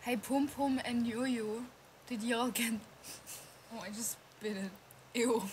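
usual speaking voice

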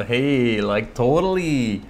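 drawn-out caricature of Californian American speech; slow, exaggerated California accent; mock Californian accent. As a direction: stretch it out like a hammed-up Californian. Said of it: "That's California".

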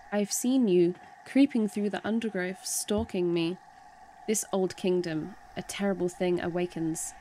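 low, measured dramatic reading; game dialogue read aloud. Acted. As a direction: read it as a grave warning, pitched low. Reads game character's dialogue aloud.

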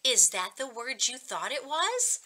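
normal talking voice

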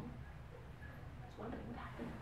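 normal talking voice

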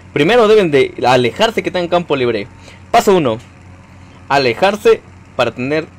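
tutorial narration, step by step; mock tutorial voice. Shown as a demonstration. Playful how-to for viewers on flying. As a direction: announce each step like a teacher. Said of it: "Acabó el tutorial".

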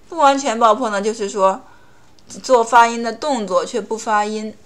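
ordinary talk

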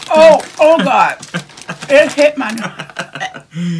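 disgusted outcry. Real, not acted. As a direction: cry out in disgust after a bad taste. Spitting out licorice, gagging.

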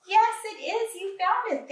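excited praise. Real, not acted. Teacher cheering a child who found it, excited.

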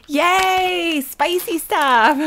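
high, slow cheering; excited cheer. Real, not acted. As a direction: cheer it out giddily. Cheering over spicy snacks.

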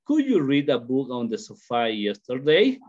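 slow model question, YESTERDAY rising at the end; question intonation. Shown as a demonstration. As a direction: read it out slowly as a question, lifting "yesterday". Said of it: "It is a question".

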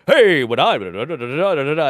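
overforced, loud booming announcer blurt; radio puker voice. Shown as a demonstration. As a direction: push it out from the diaphragm, way too hard. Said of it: "pukers on the radio are like".

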